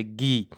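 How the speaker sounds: گی repeated alone with a hard g; German letter G. Shown as a demonstration. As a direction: model گی as the German name of G.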